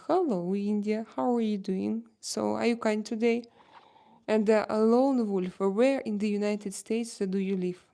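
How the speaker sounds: normal talking voice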